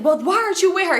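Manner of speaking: high imitated scolding; retold yelling. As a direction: throw the question at her, imitating anger. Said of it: "He yelling at her".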